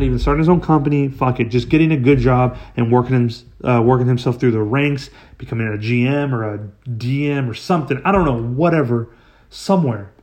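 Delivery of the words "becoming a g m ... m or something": ordinary talk, nothing marked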